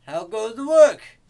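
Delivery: high, loud farmer's holler; farmer calling out. A narrator's reading. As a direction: call it out loudly across the farmyard. Farmer calls out in story.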